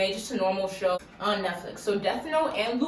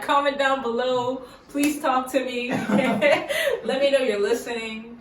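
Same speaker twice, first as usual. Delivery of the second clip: louder, laughing plea to viewers; mock pleading; 5 semitones higher, 6 dB louder than usual. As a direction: beg for comments, loud and playful.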